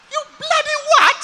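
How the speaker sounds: very high, loud English lady shriek; outraged disbelief. Acted. English lady hearing her country is unknown, outraged disbelief. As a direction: explode in affronted English outrage. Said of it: "She couldn't believe it".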